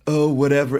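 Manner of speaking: a light put-on British "oh whatever"; lightly imitated British sigh; mock British. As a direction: toss it off in a mock British accent. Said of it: "He's British as well".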